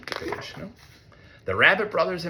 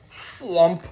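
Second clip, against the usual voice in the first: ordinary delivery, nothing marked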